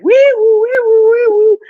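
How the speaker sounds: high loud wee-woo siren; siren imitation. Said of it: "Sound the alarm".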